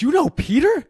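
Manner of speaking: high, loud, thrilled voice; young man thrilled to learn the host knows Peter, high and loud; energetic excitement. Retold or imitated. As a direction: burst out with excited recognition. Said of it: "energy in his face".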